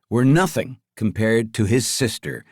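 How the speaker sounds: ordinary delivery, nothing marked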